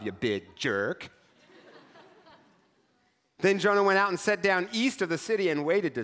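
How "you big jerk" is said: grumbling aside. Acted. Jokey insult ending Jonah's prayer.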